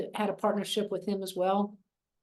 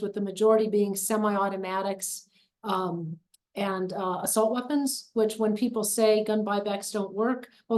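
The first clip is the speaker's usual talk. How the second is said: normal talking voice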